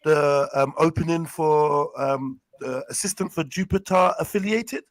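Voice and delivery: loud put-on posh job call; plummy interview voice; pretend posh voice. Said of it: "my pretend posh voice".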